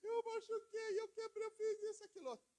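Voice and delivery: high, whiny mock crying child; hammed-up child sobbing to its parent. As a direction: whimper it like a hurt little kid. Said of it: "a criança vem chorando".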